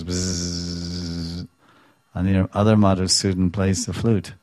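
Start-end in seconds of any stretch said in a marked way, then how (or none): 0.0-1.5 bee buzz. Retold or imitated. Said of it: "One Madhusudan goes".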